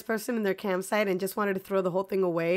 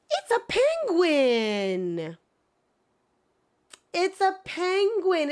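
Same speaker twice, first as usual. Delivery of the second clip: drawn-out then high delighted cry; excited reveal; 7 semitones higher, much slower than usual. Real, not acted. Streamer revealing the villager to chat, drawn-out then high delight.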